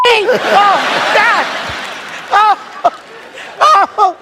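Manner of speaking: high, laughing cries of dread; mock despair. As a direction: whimper it like doom is coming. Dealer flips winning card.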